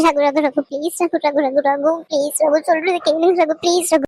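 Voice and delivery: low, pleading through tears; repeated tearful begging. Acted. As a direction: beg him not to leave, near crying. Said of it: "தயவு செஞ்சு அழுகாத".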